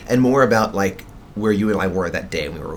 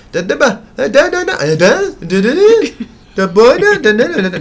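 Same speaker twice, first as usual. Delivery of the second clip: put-on high, loud toddler babbling; baby babble; 8 dB louder, slower than usual. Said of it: "she never stops talking".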